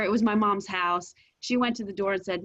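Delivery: usual speaking voice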